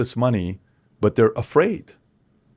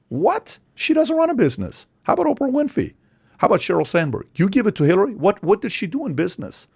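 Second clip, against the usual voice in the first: incredulous outrage; 9 semitones higher than usual. Real, not acted. Outrage at the Forbes award.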